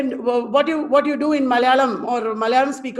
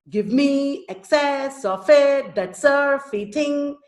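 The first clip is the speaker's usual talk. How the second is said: slow chanted verse, leaning on "me", "cess", "it"; iambic stress; 3 semitones higher, slower than usual. Shown as a demonstration. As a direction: punch every second syllable, as in "Give ME". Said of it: "Perfect iambic pentameter".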